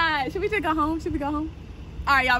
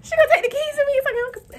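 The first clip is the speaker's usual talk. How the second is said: alarmed; 12 semitones higher, faster than usual. Real, not acted. Panics about losing keys.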